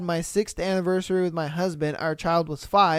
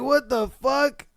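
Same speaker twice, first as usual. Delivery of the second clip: normal talking voice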